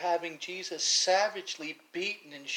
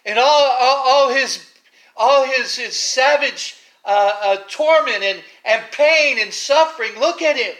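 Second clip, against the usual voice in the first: loud, surging sermon delivery, climbing higher; preaching at full force; 8 semitones higher, 20 dB louder than usual. Acted. Preacher to his congregation, painting the scourging, full-force preaching. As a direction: pour it on like a revival preacher describing the beaten Christ.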